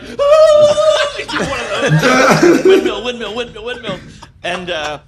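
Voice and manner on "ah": high, loud wild war cry; battle scream. Retold or imitated. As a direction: scream like a man gone berserk. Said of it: "So Packy's like".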